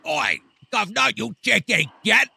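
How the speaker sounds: loud, high hammed-up Cockney insult; mock British accent. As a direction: bark it like a cartoon Londoner. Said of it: "I've been practicing".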